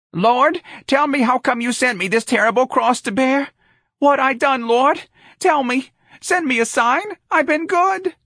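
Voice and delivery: very high-pitched pleading wail to heaven; shrill, theatrical martyred lament; wailing mother. Acted. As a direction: cry out in melodramatic prayer, pitch held high. Mother wails to God on knees.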